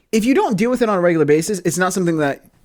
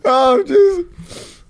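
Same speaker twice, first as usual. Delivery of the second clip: slow, high, wheezing through laughter; gasping it out mid laugh; 10 semitones higher, much slower than usual. Real, not acted. Host cracking up over his friend's story, laughing.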